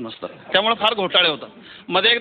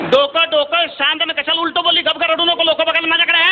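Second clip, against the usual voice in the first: scolding brother on the phone; 6 semitones higher than usual. Retold or imitated. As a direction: tell her off then calm her. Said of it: "फक्त ताईच म्हणाला".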